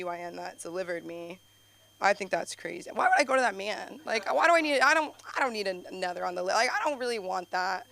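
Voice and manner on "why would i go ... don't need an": usual speaking voice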